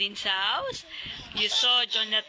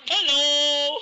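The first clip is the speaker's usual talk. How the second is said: much louder, higher hello; big mock hello; 5 semitones higher, 7 dB louder than usual. Mother imitating the uncle for the child, big loud hello. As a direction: boom out a jolly greeting.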